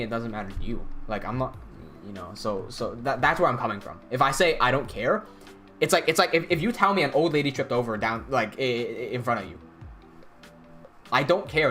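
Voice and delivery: ordinary talk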